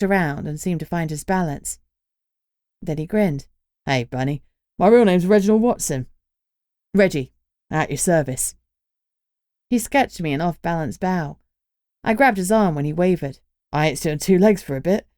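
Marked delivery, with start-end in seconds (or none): 3.8-8.5 cheeky young Cockney lad, lightly voiced; a grinning Cockney introduction; Cockney accent. Reggie introduces himself, Cockney voice. 13.7-15.1 light Cockney lad, joking about his legs; a playful Cockney quip; joking Cockney accent. Acted. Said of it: "he joked in a Cockney accent".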